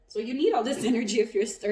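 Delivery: loud and fast, laughing through the words; laughing loudly. Real, not acted. Reader laughing loudly to her viewer about their energy. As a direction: burst out laughing as you explain.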